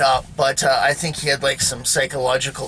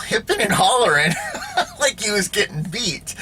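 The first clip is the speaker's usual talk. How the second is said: laughing; 8 semitones higher than usual. Real, not acted. Laughing at the stepdad's trick.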